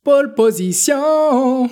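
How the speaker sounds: stretched-out mock game voice; game announcer. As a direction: imitate an arcade game's title call. Imitates the arcade game's announcement.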